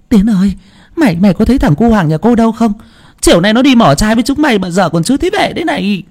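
high and fast, urgent questioning; a worried mother pressing the boy; anxious mother. A narrator's reading.

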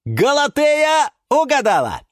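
high, loud exclamation; excited announcement. Acted. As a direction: announce the winner with delight. Yura announces the right guess. (радостно восклицая)

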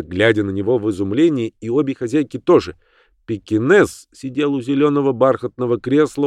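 plain reading voice

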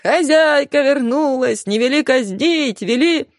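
hammed-up grovelling outcry; mock wailing. A narrator's reading. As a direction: cry out like servants begging the mistress for mercy. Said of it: "рухнули на пол и завопили".

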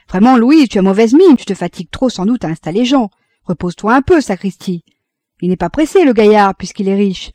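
loud, lively scolding concern; fussing husband. A narrator's reading. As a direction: chide her loudly out of concern. Husband fussing over tired wife.

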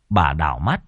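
usual speaking voice

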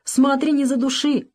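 put-on old father begging to be set down; pleading. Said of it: "взмолился старик". (взмолился, высоким голосом)

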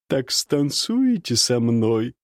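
slow teasing woman's question; flirty woman. Acted. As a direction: draw the question out coyly. Nastasya voiced. (кокетливый женский голос)

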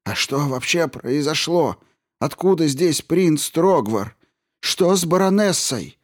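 high, rising anxious questions; agitated questions. A narrator's reading. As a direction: pour out worried questions. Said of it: "в волнении стискивая руки".

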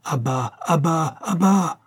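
high, drawn-out cry, rising at the end; repeated shouted command. A narrator's reading. Man with a stick fending off a dog, crying out. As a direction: order the dog down, stretching the words.

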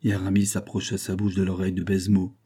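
usual speaking voice

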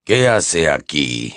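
cold low sneer; Snape's growl. A narrator's reading. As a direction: drag it out with loathing. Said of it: "gruñó".

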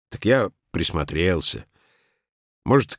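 ordinary delivery

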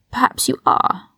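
a whispered sneer. A narrator's reading. Malfoy whispers a sneer to Ron.

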